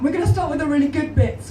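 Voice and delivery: normal talking voice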